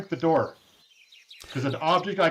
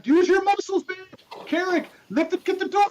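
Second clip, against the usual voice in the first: urgent; 3 dB louder than usual. Real, not acted. Urging party to lift door.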